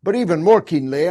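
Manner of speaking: ordinary talk, nothing marked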